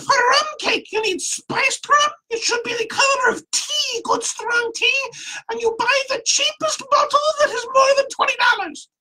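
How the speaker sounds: put-on a tiny old woman firmly giving the rules in a squeak; squeaky old woman laying down rules. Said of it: "this high-pitched, squeaky voice".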